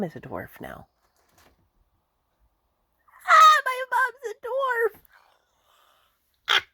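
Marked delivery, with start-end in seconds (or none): 3.3-6.6 very high squealed mock cry; mock wail. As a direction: wail it loudly in exaggerated, joking dismay. Mock outcry about her mom.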